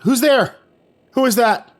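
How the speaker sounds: loud, high call into the dark. Acted. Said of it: "Ian called out".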